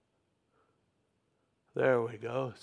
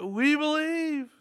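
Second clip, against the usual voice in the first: rallying cry; 10 semitones higher than usual. Acted. Preacher voicing the congregation's belief, high rallying cry. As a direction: call it out high, like a crowd declaring. Preacher echoing a crowd's declaration.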